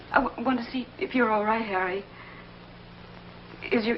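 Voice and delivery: ordinary talk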